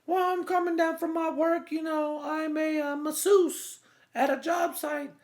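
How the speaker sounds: high falsetto woman's voice; squeaky put-on lady voice for the masseuse; high girly voice. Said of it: "And she's like".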